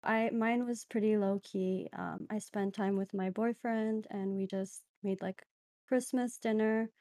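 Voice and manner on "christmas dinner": ordinary talk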